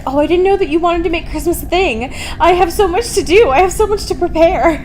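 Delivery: mock a fretting perfect housewife; mocking Edward. Mocking Edward fretting to Bella about Christmas, high and fussy. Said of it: "And he's like".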